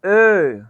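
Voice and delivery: ए said with rounded lips for o-umlaut; o-umlaut demo. Shown as a demonstration. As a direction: round the mouth on ए. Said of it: "मुंह गोल कर देना".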